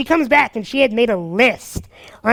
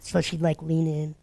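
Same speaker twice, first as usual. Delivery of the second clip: ordinary talk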